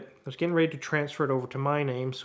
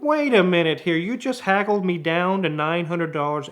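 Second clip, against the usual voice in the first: louder, higher disbelief; wait, you can't be serious; incredulous; 3 semitones higher, 6 dB louder than usual. Retold or imitated. Mechanic to customer who can't pay, incredulous and loud.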